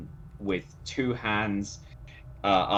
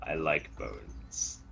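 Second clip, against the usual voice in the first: simple creature; 6 semitones lower, 6 dB quieter than usual. Acted. Player voices simple-minded creature Brutus.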